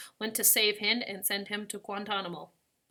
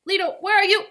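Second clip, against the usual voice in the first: high, loud worried call; raised, urgent shout; alarmed call; 10 semitones higher, 10 dB louder than usual. A narrator's reading. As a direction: call out for missing grandfather. Granddaughter calls out for grandfather.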